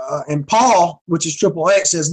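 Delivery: ordinary talk, nothing marked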